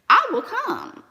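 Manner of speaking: high, tearful mock sob; fake-cry the promise. To the bride, a hammed-up tearful promise.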